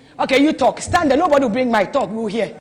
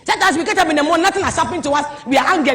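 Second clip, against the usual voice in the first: normal talking voice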